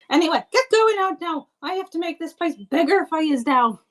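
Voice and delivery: high-pitched woman's character voice; bustling hostess. Acted. Woman sending her guest off, high bustling voice. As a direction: shoo them out in a high voice.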